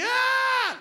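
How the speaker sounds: high, loud imitated scream of pain; retold scream. Dad retelling his son's cry of pain, high loud yelp. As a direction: mimic the son's howl as he falls. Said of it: "il crie".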